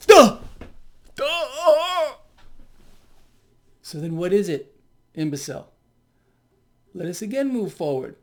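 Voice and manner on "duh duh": loud, high-pitched mocking "duh". Real, not acted. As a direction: blurt it out like it's idiotically obvious. Mocking the economist's quoted claim.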